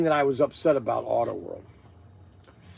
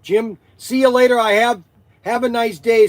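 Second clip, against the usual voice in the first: calling out; 7 semitones higher, 8 dB louder than usual. Real, not acted. Shouting goodbye to a viewer.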